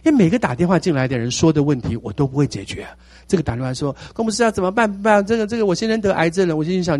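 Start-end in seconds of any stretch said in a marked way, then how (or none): 4.1-6.5 higher, pleading caller voice; a worried caller begging for help; anxious caller. Retold or imitated. Imitating a caller asking the pastor what to do.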